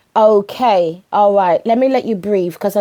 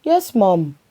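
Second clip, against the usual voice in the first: put-on sulky teen "yes, mum"; as if the question is stupid; with an attitude; 2 semitones lower than usual. Son answering mum with a bit of attitude. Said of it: "With an attitude".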